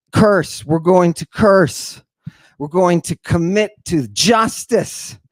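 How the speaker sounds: ordinary talk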